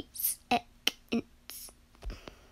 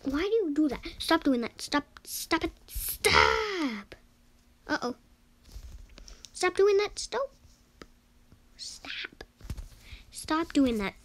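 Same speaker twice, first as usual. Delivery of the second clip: loud exasperated stop; annoyed yelling; 4 semitones higher, 4 dB louder than usual. Real, not acted. Yelling at another player to stop the annoying move. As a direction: yell at them to quit it.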